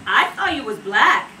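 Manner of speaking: loud, mock-incredulous quote; incredulous challenge. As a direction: call out a puzzled challenge. Friends' retold challenge.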